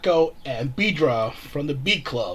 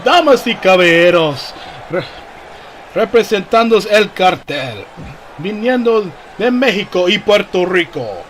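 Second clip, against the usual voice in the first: lucha libre announcer; 4 semitones higher, 9 dB louder than usual. Acted. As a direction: stretch the names out for the crowd. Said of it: "El Cartel's personal ring announcer".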